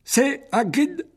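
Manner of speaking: high, slow creature question; old spider asking. Acted. Aragog asks if it is Hagrid, high and slow. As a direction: ask slowly in a strange high spider voice. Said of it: "demanda Aragog".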